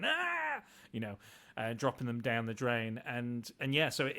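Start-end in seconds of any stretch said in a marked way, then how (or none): 0.0-0.6 sudden high yelp of fright; mock scream. Man retelling the film scene, high startled yelp. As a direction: yelp high as the rat falls. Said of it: "like, going".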